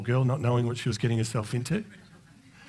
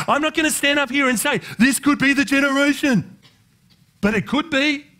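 high, urgent pulpit cry; full-force preaching; 12 semitones higher, 5 dB louder than usual. Real, not acted. Preacher urging the congregation to prepare for the bridegroom, full-force preaching. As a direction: preach it high and loud to the whole room.

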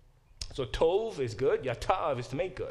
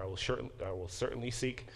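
"I will certainly seek" lowered, as a statement, no question; plain statement; 4 semitones lower, 6 dB quieter than usual. Shown as a demonstration. Teacher showing a translation without the question, "I will certainly seek" as low statement. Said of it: "sounds like she's making a statement".

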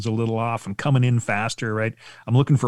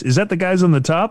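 ordinary talk, nothing marked

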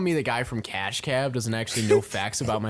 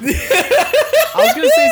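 laughing; 10 dB louder, slower than usual. Real, not acted. Laughing at his own joke.

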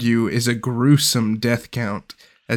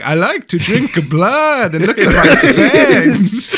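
high, slow, laughing vampire mimic; mock vampire; 9 semitones higher, 5 dB louder, slower than usual. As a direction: ham up a vampire boast through laughter. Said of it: "Brody's just like".

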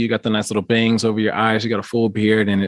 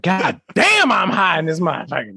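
excited high exclamation; 7 semitones higher than usual. Retold or imitated. Retold stoned exclamation.

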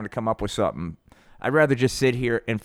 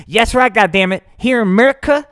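loud, high ranting patriot; high, loud blowhard rant; Hank; 6 semitones higher, 6 dB louder than usual. Acted. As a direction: yell it like a riled-up podcast host. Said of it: "that's Hank".

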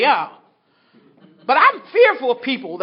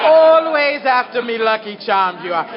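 slow, hammed-up Irish leprechaun lilt, a bit louder; mock Irish accent; 4 dB louder than usual. Teasing the English sponsor in a mock Irish accent. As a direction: drawl Always after me lucky charms like a cartoon Irishman. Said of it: "Stop making fun of me. That's Ireland".

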